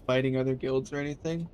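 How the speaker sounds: ordinary talk, nothing marked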